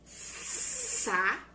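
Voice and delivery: SAPO as SA, pause, PO, with a long hissed S; "Sapo" broken in two for counting syllables; split into syllables. Shown as a demonstration. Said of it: "Sílaba é quando eu vou juntar".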